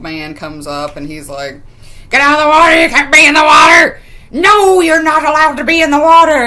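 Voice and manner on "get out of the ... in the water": loud, high-pitched hollering in character; old man's scolding shout. Retold or imitated. Old man ordering girls out of the water, scolding shout. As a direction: yell it like an angry old man chasing off swimmers.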